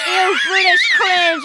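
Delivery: high, loud, drawn-out heckle; mocking jeer. Imitating viewers jeering at the streamer's accent, mocking. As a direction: mimic a disgusted heckler, big and stretched out. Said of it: "They're like".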